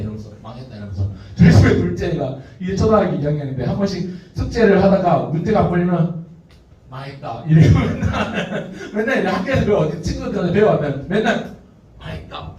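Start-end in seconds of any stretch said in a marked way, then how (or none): 0.4-0.8 soft low groan of defeat; deflated mutter. Retold or imitated. Child giving up. 6.9-7.4 soft low groan of defeat; deflated mutter. Retold or imitated. Son's habitual phrase. 12.0-12.5 breathy whisper of giving up; whispered defeat. Retold or imitated. Son's habitual phrase.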